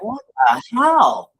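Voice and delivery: high-pitched, drawn-out incredulous outburst; mock bewilderment. Telling a friend about a sudden road bump, in high-pitched disbelief. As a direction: stretch it out high in comic disbelief.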